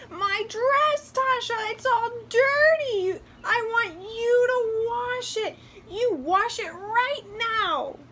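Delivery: high, loud tantrum; screaming doll. A narrator's reading. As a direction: scream orders as a spoiled little doll. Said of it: "started to scream".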